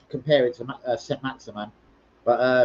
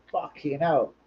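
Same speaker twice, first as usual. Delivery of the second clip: ordinary talk, nothing marked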